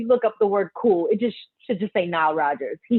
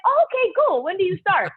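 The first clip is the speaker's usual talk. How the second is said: much higher, delighted and impressed; 10 semitones higher than usual. Retold or imitated. Nile impressed after the audition, offering her the job with delight. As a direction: sound won over by the audition. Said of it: "He's like".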